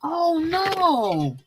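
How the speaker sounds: reacting with shocked sympathy to a guest's loss; dismayed oh no. Real, not acted. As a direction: let the news land, aghast and sorry. Reacting to comrade's suicide news.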